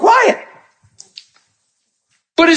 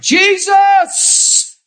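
desperate call; 9 semitones higher than usual. Acted. Blind man calls to passing Jesus.

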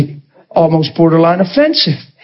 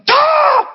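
exasperated cry. Retold or imitated. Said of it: "And I'm like".

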